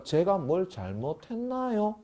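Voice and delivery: hurt question. Acted. Younghee hurt by scolding.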